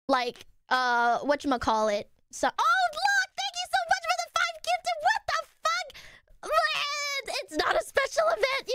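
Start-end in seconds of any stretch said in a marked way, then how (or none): 2.5-8.7 very high-pitched squealing thanks; overwhelmed excitement. Real, not acted. Streamer surprised by five gifted subs, high overwhelmed squeal. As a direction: squeal in disbelief at a shower of gifts.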